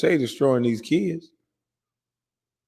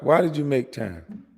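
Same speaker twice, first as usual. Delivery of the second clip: ordinary talk, nothing marked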